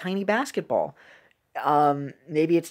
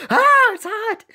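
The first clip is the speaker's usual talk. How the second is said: high, loud comic yelp of pain; mock scream; 7 dB louder, slower than usual. Voicing the cartoon man burning his mouth, high mock scream. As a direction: shriek it like a burnt cartoon man. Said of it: "he's just screaming instead".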